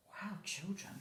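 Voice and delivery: soft unvoiced whisper to the listeners; whispered aside. Shown as a demonstration. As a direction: lean in and whisper. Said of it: "storyteller whispers aside to children".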